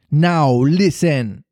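commanding cry. A narrator's reading. Hook ordering his captive boys to listen, slow loud cry. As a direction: bark it slowly to hush the crew. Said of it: "cried Hook".

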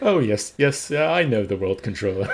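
loud, puffed-up name-dropper; mock boasting. Co-host mocking Bernard's name dropping, hammed-up boasting. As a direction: mimic a self-important show-off.

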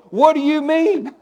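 high, slow, exasperated cry; mock frustrated outburst. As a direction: play the fed-up Jesus asking in disbelief. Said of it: "my flesh would want to get in here going".

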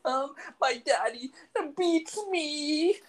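put-on very high, small child's voice, slow; little boy voice. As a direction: play a scared little kid on the phone. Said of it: "he pretended to be a little boy".

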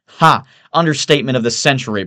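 loud, quick derisive outburst; crying out. A narrator's reading. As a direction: pounce with a scornful ha. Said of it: "Price cries out".

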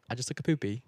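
soft, tentative British accent. Shown as a demonstration. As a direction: try it quietly in a British accent. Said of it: "British accent real quick".

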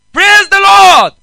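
bus preacher. Retold or imitated. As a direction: belt it like a street preacher on a bus. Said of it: "somebody came to preach".

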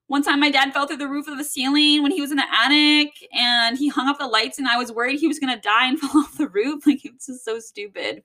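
ordinary delivery

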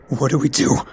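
high, panicked plea; desperate. A narrator's reading. Fallen soldier begs his commander for guidance, desperate. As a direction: plead for direction as panic threatens to take over. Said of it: "I said desperately".

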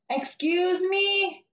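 high, dragged-out mock customer whine; spaced-out customer. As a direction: imitate her calling out, slow and insistent. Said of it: "I don't know what she was off".

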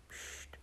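very soft voiceless "psst"; secretive hush for quiet; hushed shush. Acted. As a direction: shush them barely audibly. Finger on lips, shushing.

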